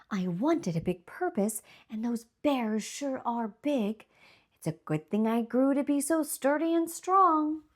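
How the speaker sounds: soft, proud little tree voice; musing to himself, softly pleased; lightly voiced oak tree. The oak tree thinking proudly about the big bears, softly voiced. The oak tree's inner thoughts.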